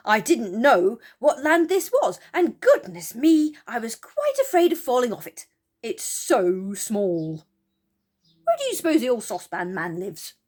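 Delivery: lightly voiced fretful Moonface. Moonface telling the children his fear of falling, lightly flustered. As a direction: rattle on, half afraid.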